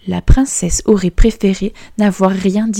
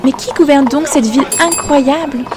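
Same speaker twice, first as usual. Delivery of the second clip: much higher, awed princess; amazed question; 7 semitones higher than usual. Acted. Princess asking the fiddler who rules the city, higher and amazed. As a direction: marvel at the city. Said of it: "la princesse demanda au violoniste".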